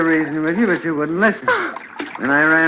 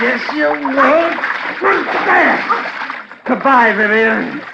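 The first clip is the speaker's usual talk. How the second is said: strained shout; 9 semitones higher than usual. Acted. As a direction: shout it while fighting her off. Husband shoves her off.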